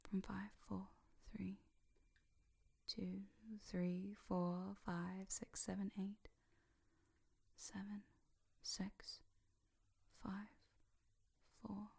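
soft, spaced-out trance count; hypnotic counting. Shown as a demonstration. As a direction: count softly with long pauses, lulling them under. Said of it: "Just like the counting down".

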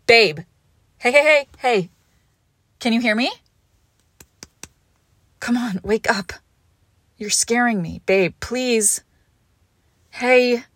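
loud, frightened pleading; panicked. Acted. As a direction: beg them to wake up, scared. Partner fainted, panicked pleading.

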